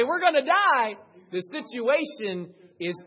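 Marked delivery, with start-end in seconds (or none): 0.0-1.0 high, loud, terrified wail; a loud high mock terror; panicked cry. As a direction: cry out that death is near. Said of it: "fishermen in a boat say".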